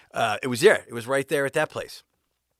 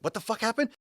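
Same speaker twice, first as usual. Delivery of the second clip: shocked question; 12 semitones higher than usual. Retold or imitated. Buddy shocked at news.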